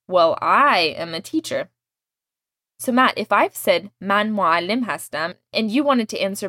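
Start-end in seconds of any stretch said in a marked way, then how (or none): 0.1-1.7 "I" stretched slowly and stressed. Shown as a demonstration. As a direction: hold the word I long to emphasize it. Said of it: "This just emphasizes".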